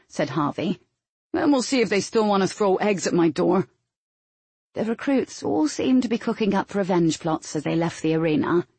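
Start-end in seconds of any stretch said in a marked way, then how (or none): 1.3-3.7 cocky recruit. A narrator's reading. Recruit boasts of revenge on squads.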